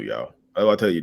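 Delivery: ordinary delivery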